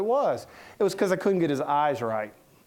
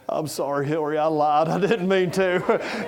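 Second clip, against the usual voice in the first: Bill Clinton impression. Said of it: "And I'd be like".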